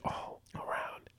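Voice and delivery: very soft, slow whisper; imitated ad whisper. Podcaster mimicking the commercial that came on, slow whisper. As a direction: breathe it out in a whisper.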